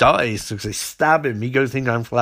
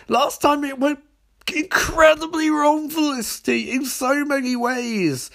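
mock outrage; 13 semitones higher than usual. [name] objecting to Felicity's dinner idea, squealing mock outrage. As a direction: hold the words high in hammed-up protest.

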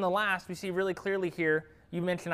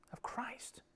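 hushed, breathy whisper; awed whisper; 6 semitones higher, 15 dB quieter than usual. Real, not acted. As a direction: drop to a whisper in awe. Awed hush over Christ's vision.

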